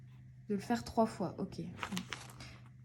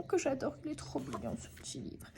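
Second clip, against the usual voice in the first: whispering; 3 semitones higher, 10 dB quieter, faster than usual. Real, not acted. Fond aside about new book.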